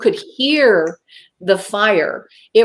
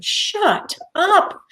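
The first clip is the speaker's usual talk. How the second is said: high, stretched-out shut-up; acted-out inner voice of reason; scolding herself; 11 semitones higher than usual. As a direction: rebuke yourself in a high voice. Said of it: "my reason was saying".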